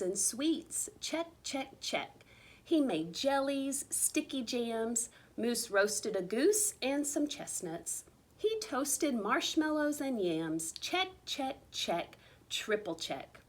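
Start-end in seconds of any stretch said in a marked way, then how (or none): none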